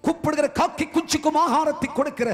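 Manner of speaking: high, loud impassioned cry; fervent preaching. Acted. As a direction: preach it out at full force. Preaching at full force.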